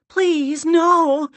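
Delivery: loud, high, drawn-out plea; alarmed cry. A narrator's reading. Larissa begging it to stop, alarmed cry. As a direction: cry out in dread. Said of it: "Larissa cried".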